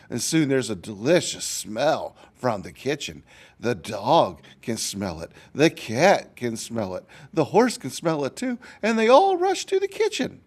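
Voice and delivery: put-on lively storybook narration for kids; reading to children. A narrator's reading. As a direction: read it like a picture book to a class. Teacher reads story to children.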